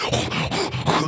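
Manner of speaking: high airy gasps; imitated excited gasps. Crowd bouncing to the band, excited gasps.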